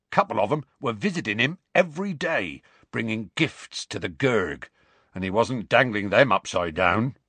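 rustic character voice, grudging account; Hagrid, disgruntled. Acted. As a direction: report it sourly in dialect. Hagrid explains grimly.

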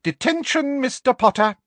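very high, ringing, loud trill; triumphant. A narrator's reading. Professor giving Harry detention, triumphant. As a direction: crow the punishment, pitched right up. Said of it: "said Professor Umbridge triumphantly".

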